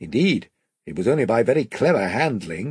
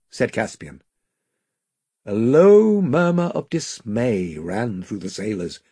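plain reading voice

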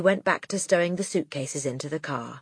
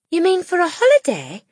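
gentle teacher; 12 semitones higher, 6 dB louder than usual. Acted. Miss Honey voiced.

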